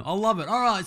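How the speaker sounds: ordinary delivery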